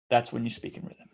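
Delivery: a quiet closing beat. Shown as a demonstration. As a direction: drop the voice to land the point. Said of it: "which I stopped with a pause, lowered my voice".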